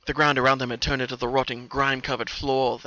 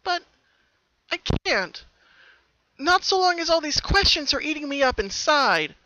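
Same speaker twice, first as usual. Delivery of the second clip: sad Pinkie; 14 semitones higher, 6 dB louder than usual. A narrator's reading. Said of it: "She lowered her head".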